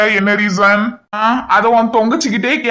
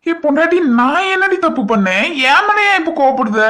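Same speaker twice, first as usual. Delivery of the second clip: higher, pleading to his wife; husband coaxes his sulking wife, high and pleading; high coaxing plea; 7 semitones higher than usual. Acted. Playful high coaxing.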